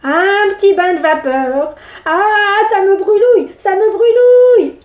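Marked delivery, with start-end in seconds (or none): 2.0-4.8 high, loud pained cries; squirming in pain. Acted. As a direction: cry out from the burning. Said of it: "se tordit-il".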